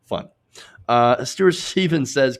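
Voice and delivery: normal talking voice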